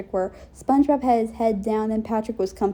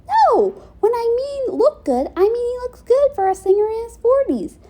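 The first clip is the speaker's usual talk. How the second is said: flustered denial; 12 semitones higher than usual. Acted. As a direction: deny it in a startled squeal.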